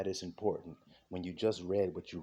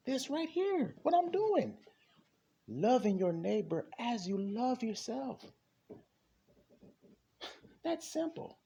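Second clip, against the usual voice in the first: high-pitched insistence; 13 semitones higher, slower than usual. Real, not acted. Preacher pointing listeners to the commandment, insistent and high. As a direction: voice leaping very high.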